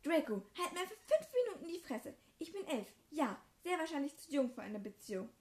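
high, loud defiant tirade; girl furiously standing up to her brother; angry outburst. Acted. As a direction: tell your brother off in fury. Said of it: "Wütend drehte ich mich um".

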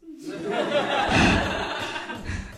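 long breathy laugh. Real, not acted. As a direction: dissolve into airy laughter. Laughs amid the retelling.

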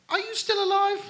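high, incredulous question. Real, not acted. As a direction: ask it in disbelief. Absurd question, high pitch.

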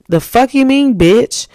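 loud indignant retort; mock outraged yell. Retelling her furious childhood reply to the girl. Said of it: "I'm like".

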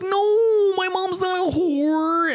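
whiny protesting listener. Retold or imitated. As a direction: plead like a wounded kid.

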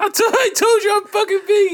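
high, loud entitled customer; mock Karen yelling at staff. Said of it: "That's definitely a Karen move".

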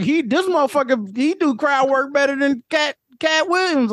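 high-pitched imitation of gushing fans; mock hype. Podcaster mocking TikTok fans who overpraise a comic, mock hype.

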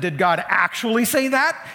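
loud, high, incredulous question; undermining doubt. Retold or imitated. Satan questioning Eve about God, undermining. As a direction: lean on "actually" to cast doubt. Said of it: "It's not an innocent question".

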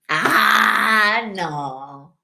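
alarido fingido que cae en un no; grito dramático. Acted. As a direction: gritar largo y bajar al final. Acts out going crazy locked up. (grito teatral de locura)

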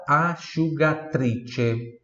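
slow asciugatrice, sci as sh; slow model word. Shown as a demonstration. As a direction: draw out asciugatrice slowly. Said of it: "Repita em voz alta". (asciugatrice bem devagar)

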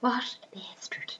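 very soft whisper; whispered aside. Real, not acted. Co-host reacting to the stolen gold, whispered. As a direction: whisper it under the other speaker. Hushed aside on the story's theft.

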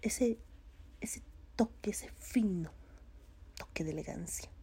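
a playful hush of refinement; hushed mock elegance. Vlogger praising the English accent, whispered and mock-refined. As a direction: whisper "ese fino toque de elegancia" softly.

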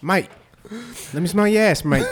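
put-on high, loud British-accented dog greeting. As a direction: eagerly hail another dog in mock British. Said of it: "he'd have a British accent".

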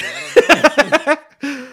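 laughing it off, drawn out and high. Real, not acted. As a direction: laugh through the words slowly. Laughing denial about drunken night.

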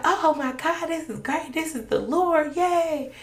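high, gushing oh my God with a laugh; joyful excitement. Retold or imitated. Mother thrilled at the baby's name, high and laughing. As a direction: burst with delight.

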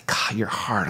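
soft, unvoiced whisper; comforting a grieving friend in a whisper; whispered sympathy. Shown as a demonstration. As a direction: breathe it gently, barely voiced.

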